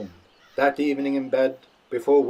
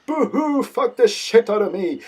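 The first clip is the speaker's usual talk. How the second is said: much higher, loud blubbering wails; hammed-up boo-hoo sobbing through the words; crying; 10 semitones higher, 8 dB louder, slower than usual. Grandpa blubbering with pleasure, high wailing cries. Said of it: "Grandpa began to cry".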